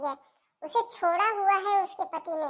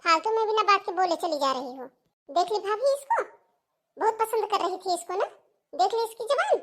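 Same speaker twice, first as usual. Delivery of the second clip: heated, raised-pitch telling-off; scornful scolding; 4 semitones higher than usual. Acted. Relative scolding Seema for talking back, scornful. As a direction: put her down, pitch climbing indignantly.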